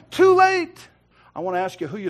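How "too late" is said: loud, high cut-off of a protest; dismissive police officer. Acted. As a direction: shut the excuse down forcefully.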